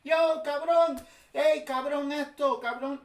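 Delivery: high, loud, teasing call of cabrón; playful Spanish banter. Retold or imitated. Explaining Puerto Rican slang to viewers, high teasing cabrón calls. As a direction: toss out cabrón like greeting a buddy. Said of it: "It's like in Spanish, we say".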